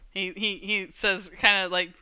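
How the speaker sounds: usual speaking voice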